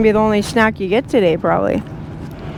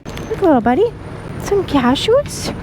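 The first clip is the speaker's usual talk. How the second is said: talking to a pet; 7 semitones higher than usual. Real, not acted. Talking sweetly to a crow.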